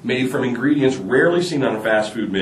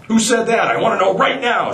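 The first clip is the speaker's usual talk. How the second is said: angry demand; 10 semitones higher than usual. Acted. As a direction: bark it at the class like an outraged teacher. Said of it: "she angrily demanded".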